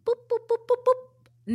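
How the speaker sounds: high beeping keypad tones; phone dial beeps. Pretend. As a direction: mimic dialing a phone. Dialing phone keypad sounds.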